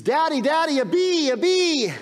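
put-on high, loud panicked little girl; terrified child. Little daughter screaming at dad about a bee, high panicked cry. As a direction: squeal it like a scared toddler. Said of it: "She begins to scream wildly".

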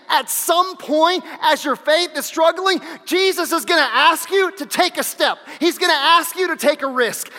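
loud, driving sermon build; fiery preacher pressing the point; preaching at full force. Acted. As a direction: push it hard to the back row.

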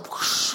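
whoosh of blown breath; airy, voiceless wind noise; imitated whoosh. Shown as a demonstration. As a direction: blow out a rushing gust of air. Imitates God's blowing breath.

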